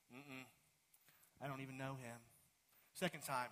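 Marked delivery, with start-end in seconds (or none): 0.1-2.2 muttered denial. Acted. Peter denies Jesus to girl.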